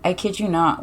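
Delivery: ordinary delivery, nothing marked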